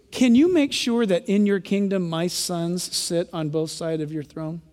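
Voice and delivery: lightly voiced sweet-talking mother; a hint of a doting mother asking a favour; mock mom request. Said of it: "and said".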